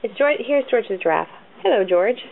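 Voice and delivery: ordinary delivery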